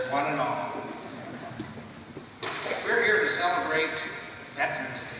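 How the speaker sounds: formal greeting to the audience; podium speech. Acted. Formal opening address.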